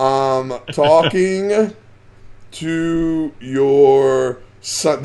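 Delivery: very slow, drawn-out words; slow dictation. Real, not acted. Host types a reply to guest's dad, slowly word by word. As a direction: stretch each word as if typing it. Repeats while typing the reply.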